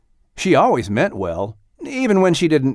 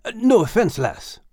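higher, awkward little apology; sheepish apology; 8 semitones higher than usual. Acted. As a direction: back off sheepishly. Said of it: "He then looked at Henriette".

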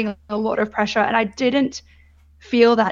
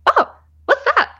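startled high question; 5 dB louder than usual. Retold or imitated. Waters break in the pool.

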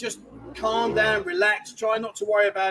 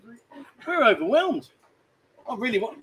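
normal talking voice

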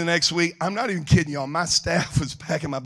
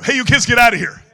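raised, louder mock scolding; bossy shooing; 7 semitones higher, 7 dB louder than usual. Disciples chase little kids from Jesus, bossy. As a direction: shoo off pesky children.

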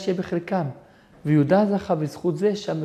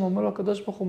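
normal talking voice

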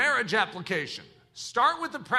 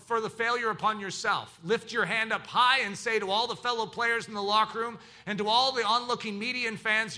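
ordinary delivery

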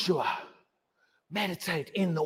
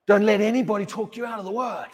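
ordinary delivery

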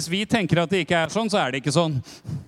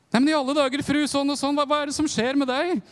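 alarmed cry; 8 semitones higher than usual. Retold or imitated. Pastor backing away from the woman, higher alarmed cry. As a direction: recoil in alarm and call out to her.

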